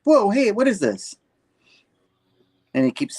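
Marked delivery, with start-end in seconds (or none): 0.0-1.1 high, loud startled yelp; startled cry. Retold or imitated. Trapped woman yelling in surprise, high and loud. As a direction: jump back, caught off guard. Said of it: "She's like".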